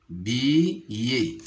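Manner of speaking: "billet" split into bi, pause, yé; syllable by syllable. Shown as a demonstration. Teacher showing pupils the two syllables, "billet" split apart. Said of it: "se compose de deux syllabes".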